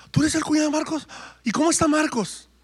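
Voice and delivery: high-pitched, eager fan asking questions; mock eager fan. People he meets quiz him about his famous brother-in-law, mock eager.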